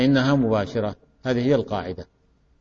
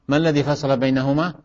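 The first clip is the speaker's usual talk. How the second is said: normal talking voice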